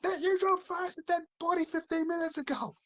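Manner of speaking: high-pitched mock Indian accent; imitated Indian accent. Reacting to drivers passing a dead body, imitated Indian accent. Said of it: "please stop doing the accent".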